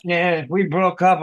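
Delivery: ordinary talk, nothing marked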